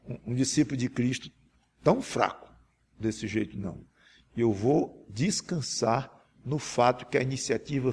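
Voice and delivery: intimate confessional prayer to God; humble prayer. Acted. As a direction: pray it honestly, like a weak disciple. (oração humilde e confessional)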